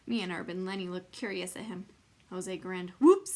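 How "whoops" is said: high, loud little whoops; oops. A narrator's reading. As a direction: catch yourself out loud. Boy catches his mistake.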